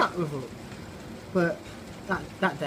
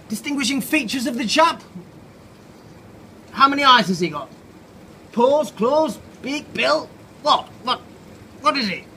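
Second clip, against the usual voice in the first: higher, louder brisk military voice; pompous army officer; 5 semitones higher, 6 dB louder than usual. Acted. Ant officer grilling Cynthia about Norman, brisk and loud. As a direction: bark questions like a stiff officer.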